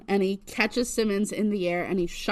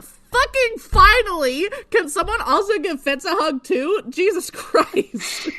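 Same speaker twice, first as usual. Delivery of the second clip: exasperated outburst; 10 semitones higher, 7 dB louder than usual. Real, not acted. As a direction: vent at the characters, breaking into laughter. Exasperated demand for a hug.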